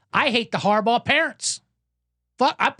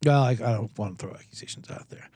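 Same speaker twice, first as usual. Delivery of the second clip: whispered aside; 10 semitones lower, 4 dB quieter than usual. Real, not acted. Whispered aside about accusations.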